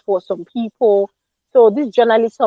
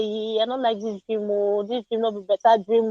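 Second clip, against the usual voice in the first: hushed, mildly fed-up grumble; quiet grumble; 7 dB quieter than usual. Retold or imitated. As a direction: mutter it half to yourself, mildly annoyed. Telling the dream aloud on waking.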